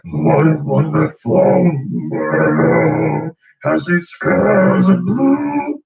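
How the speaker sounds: slow raspy high singing. Retold or imitated. Singing as an example of a raspy jazz voice. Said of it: "raspy kind of".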